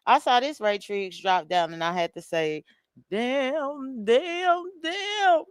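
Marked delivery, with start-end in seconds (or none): 3.1-5.4 damns climbing higher, kept soft; rising lament without the volume; Good Times mother's lament, quieter, pitch climbing; Florida Evans. Retold or imitated. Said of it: "in my Florida Evans voice".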